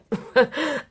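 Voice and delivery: short high self-conscious giggle; little laugh. Real, not acted. Vlogger joking she is not a popular YouTuber, giggling. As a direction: laugh off the modest joke lightly. Modest joke about being unpopular.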